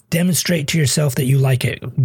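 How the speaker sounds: normal talking voice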